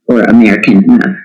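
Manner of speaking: normal talking voice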